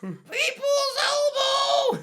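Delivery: very high, drawn-out yell; wrestling shout. Acted. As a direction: scream the move name to the crowd.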